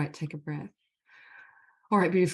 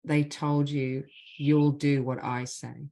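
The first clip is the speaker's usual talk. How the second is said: measured, deliberate leading of a phrase; guided healing phrases; 3 dB louder, slower than usual. Acted. Facilitator gives the group apology phrases to repeat, measured and deliberate. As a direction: lead the group line by line, leaving space after each.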